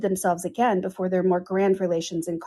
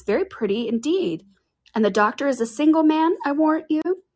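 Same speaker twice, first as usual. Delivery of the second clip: higher, playful and prying; lightly voiced teasing matron; 8 semitones higher, 3 dB louder than usual. A narrator's reading. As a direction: tease the girl good-naturedly. Narrator voices Mrs Jennings teasing.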